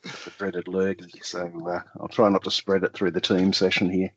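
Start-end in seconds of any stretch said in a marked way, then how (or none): 0.0-1.2 real sick-day voice, apologising with a small laugh at first; voice under the weather. Real, not acted. Guest excuses his voice to webinar host, under the weather. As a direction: talk through the dreaded lurgy. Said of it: "My apologies for my voice".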